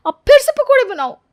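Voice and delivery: high, loud exasperated cry; fed-up outburst. Acted. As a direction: burst out at being ordered again. Priya fed up mimicking demand. (झुंझलाकर ऊँची, तेज़ आवाज़ में शिकायत)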